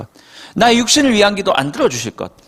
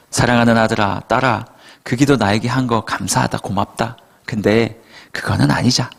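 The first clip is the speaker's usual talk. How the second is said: gentle fatherly God; 8 semitones lower than usual. Acted. God speaks to his children.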